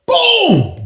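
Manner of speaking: high-pitched falling boom; imitated knockout boom.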